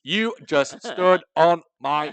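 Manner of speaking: slow, loud, emphatic scolding; mock indignation. Telling off friend for ruining joke, mock indignation.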